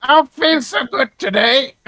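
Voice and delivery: high, slow, drawn-out character voice; Creek's put-on voice. Player tries out Creek's voice for the table, high and slow. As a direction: draw it out high and silly.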